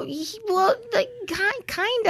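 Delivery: high, soft, crying voice; tearful admission. Retold or imitated. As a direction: sob it out guiltily, softly. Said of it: "And I go".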